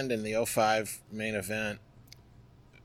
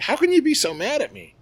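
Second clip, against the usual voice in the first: much higher, louder disbelieving plea; exasperated plea; 6 dB louder than usual. Retold or imitated. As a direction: plead with her in disbelief. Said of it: "I'm just like".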